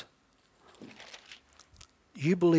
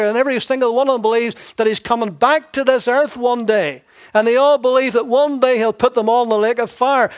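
loud, high, driving sermon build; full-force preaching; 5 semitones higher, 7 dB louder than usual. Acted. Preacher rising to full force on the devils' belief. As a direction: hammer the point home to the congregation.

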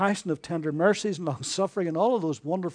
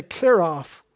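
mock rude brush-off; 9 dB louder than usual. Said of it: "somebody come up and say".

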